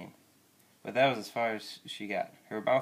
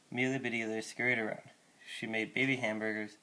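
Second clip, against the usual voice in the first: ordinary delivery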